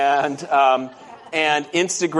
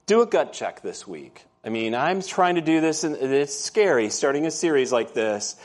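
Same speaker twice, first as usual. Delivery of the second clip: ordinary delivery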